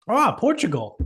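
high, sing-song mock of the stranger; mild taunting impression. Mocking the stranger again.

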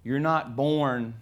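ordinary talk, nothing marked

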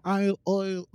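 high, faltering Irish attempt; failed Irish accent. Shown as a demonstration. Host failing to copy cohost, high attempted Irish accent. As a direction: try the Irish oy and give up. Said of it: "I can't do it".

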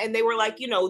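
ordinary talk, nothing marked

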